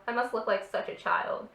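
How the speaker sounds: usual speaking voice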